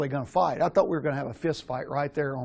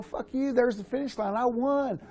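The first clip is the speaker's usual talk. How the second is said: gloating taunt; 8 semitones higher than usual. Retold or imitated. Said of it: "And I go".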